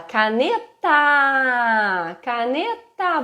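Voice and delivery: "caneta" com o A final esticado; vogal prolongada. Shown as a demonstration. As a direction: estique bem o A de "caneta", devagar. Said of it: "ampliando o som do A". ("caneta" com o A final alongado, como modelo)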